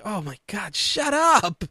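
mutter, then burst out on the last words; exasperated. Acted. Host fed up with the announce team, exasperated.

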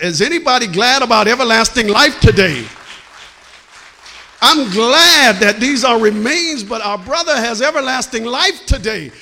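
high, loud excited preaching building to a shout; getting excited. Real, not acted. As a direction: whip the church into joy. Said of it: "I'm getting excited".

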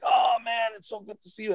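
gushing retold hello; delighted greeting. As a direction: greet an old friend, pitch soaring.

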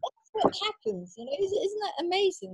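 high, squealing mock-amazed gush. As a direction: play the starstruck fan, high. Mocking gushing ladies.

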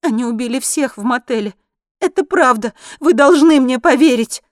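high, loud distraught woman; desperate, upset insistence; tearful. A narrator's reading. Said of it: "Женщина всхлипнула".

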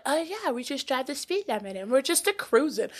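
soft, laid-back imitation; mock-mellow, hushed voice; easygoing friend. Imitates slow, content friends.

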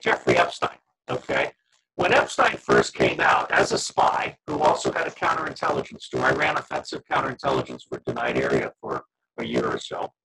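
glitchy robotic call distortion; garbled digital robot voice. Real, not acted. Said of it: "You started to sound like a robot".

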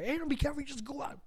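high hype-up encouragement; hype friend cheering. Retold or imitated. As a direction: pump him up like a friend. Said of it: "Kevin was trying to hype him up".

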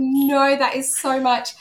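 a physical no; overwhelmed recoil. Retold or imitated. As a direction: recoil as if it's all too much. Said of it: "full physical response to it".

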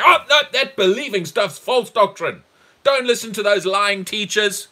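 loud, high-pitched objection; mock denouncing shout. Acted objectors denouncing the teaching.

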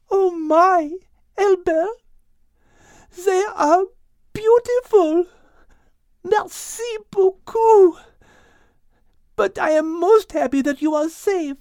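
high, loud, delighted French lady; French-accented Liz. Acted. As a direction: gush with relief and thanks. Liz voiced in the story.

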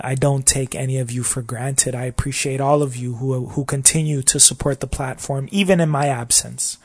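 ordinary delivery

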